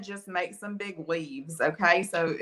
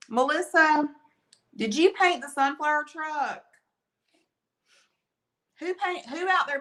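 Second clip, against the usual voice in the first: high, lively call to a viewer; raised voice, singling someone out; 8 semitones higher, 3 dB louder than usual. Real, not acted. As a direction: call out an eager question, pitched well up. Calling out to a viewer by name.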